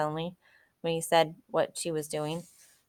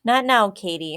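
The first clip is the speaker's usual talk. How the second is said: firm, louder reproof; stern; 4 semitones higher, 6 dB louder than usual. A narrator's reading. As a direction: shut her down sternly. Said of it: "Matt said sternly".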